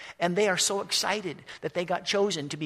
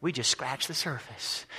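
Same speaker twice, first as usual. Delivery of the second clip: awed; 5 semitones lower, 6 dB quieter than usual. Retold or imitated. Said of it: "they go".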